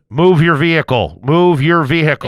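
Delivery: loud, flat command over a police car megaphone; megaphone order. Retold or imitated. Cop ordering a stalled driver over the megaphone, loud and amplified. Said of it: "over the megaphone in the car".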